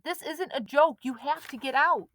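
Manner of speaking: put-on boy urging friends to leave; insistent. A narrator's reading. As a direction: press the point hard. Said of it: "Chet insisted".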